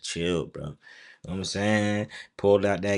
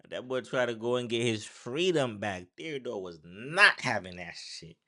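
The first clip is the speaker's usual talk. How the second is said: usual speaking voice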